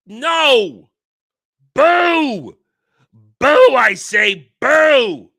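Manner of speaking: drawn-out booing, slow and loud. Real, not acted. Fan booing a Lego set, loud slow boos. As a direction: boo it down like a heckler.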